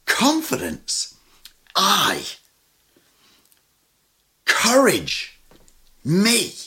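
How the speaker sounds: high, slow, each word "Confidence", "I", "Courage", "Me" set apart; stark single words. Shown as a demonstration. Preacher reading Mark's terse Greek, high separated words. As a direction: drop each word alone with long pauses. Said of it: "That's what he writes".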